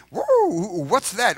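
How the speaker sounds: high, loud startled whoa; surprised stranger. Retold or imitated. Stranger reacting loudly to the preacher's T-shirt, astonished. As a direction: gasp at the T-shirt in mock amazement. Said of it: "And they ask me".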